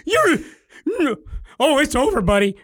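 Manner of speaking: high, loud hammed-up wail; mock despair. The dad to his son, a high, loud mock wail. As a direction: cry out in mock doom.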